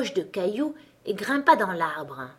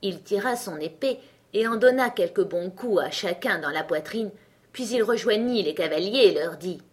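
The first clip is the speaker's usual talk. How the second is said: usual speaking voice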